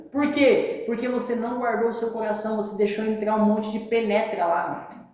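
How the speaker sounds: normal talking voice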